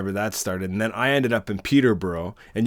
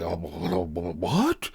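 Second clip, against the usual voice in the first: imitating a stammering, taken-aback policeman; mock bewildered stutter; flustered surprise; 3 semitones lower, 3 dB quieter, slower than usual. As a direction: stumble low, then jump up on "what".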